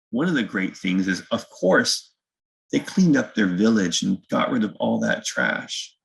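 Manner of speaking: ordinary delivery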